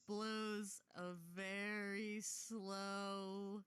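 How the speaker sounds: slow, stretched narration; very drawn-out. Acted. Game master describing a slow bubble, drawn out. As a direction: drag each word like a slowly swelling bubble.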